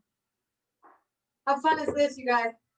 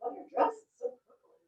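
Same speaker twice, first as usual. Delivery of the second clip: breathy, elfin mock-cheer, fading to a whisper; hushed, delighted elf impression; Buddy the Elf voice; 6 semitones higher, 8 dB quieter than usual. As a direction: play a giddy Christmas elf gushing over purple. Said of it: "I sound like Buddy the Elf".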